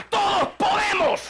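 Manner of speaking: high, slow shout on todos; climactic shout. Acted. Preacher rallying the congregation, full shout. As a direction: peak the chant with a full shout. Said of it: "climax of repeated chant".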